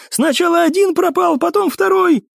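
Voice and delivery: high-pitched frantic shout; panicked cry. A narrator's reading. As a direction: blurt it out, pitch way up. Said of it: "крикнул в ответ курсант".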